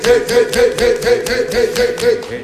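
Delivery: loud high hype chant; town crier. Acted. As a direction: belt the heys out like a crier. Podcast opening hype call.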